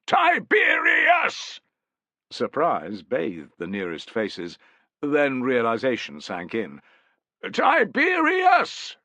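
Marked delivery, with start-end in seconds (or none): 0.1-1.6 very slow, high, loud drawn-out shout; crying out. Acted. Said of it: "and cried". 7.5-9.0 very slow, high, loud drawn-out shout; the soldiers echo the name with full force. Acted. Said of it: "shouted the soldiers".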